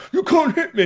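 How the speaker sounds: high-pitched mocking yelp; imitating a cosplayer's squeal; mock squeaky cry. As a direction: squeal it like a childish taunt. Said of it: "he was like".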